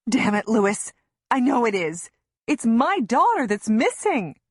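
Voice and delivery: high, loud outburst at Lewis; desperate mother lashing out loudly; angry shout. A narrator's reading. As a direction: shout it, pitch climbing as it goes. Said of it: "I shout".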